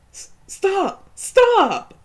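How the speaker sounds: high loud pleading cry; desperate stop. Acted. Player begging Karen to stop the cutting, high desperate scream.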